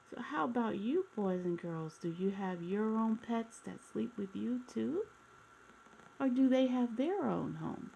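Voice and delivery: gentle read-aloud storytelling voice; storybook narration. A narrator's reading. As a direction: read it like a bedtime picture book for kids. Reading a picture book aloud.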